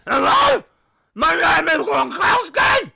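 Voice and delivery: Gronk impression. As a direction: boom it like a goofy celebrity host. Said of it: "put up with Gronk's voice".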